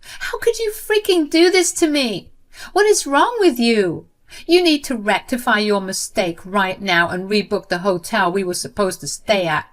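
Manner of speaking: high, loud furious shouting; acted screaming sister-in-law; angry scream. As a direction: yell it at her in rage. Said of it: "she practically screamed at me in anger".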